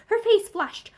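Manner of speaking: ordinary delivery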